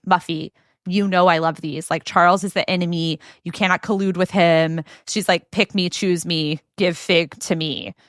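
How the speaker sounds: ordinary talk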